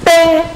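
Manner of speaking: short, high-pitched "ddaeng" for a wrong answer; mock buzzer "ding". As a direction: imitate a quiz bell in a high voice. Imitates wrong-answer buzzer sound. (높은 소리로 흉내 낸 땡 소리)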